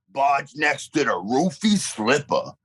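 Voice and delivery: ordinary delivery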